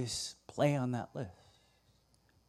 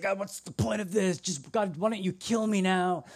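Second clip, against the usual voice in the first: higher-pitched exasperated plea; mock despair; 6 semitones higher than usual. Elijah to God, wanting to die, acted despairing plea. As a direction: complain to God in hammed-up despair. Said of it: "So he's suicidal".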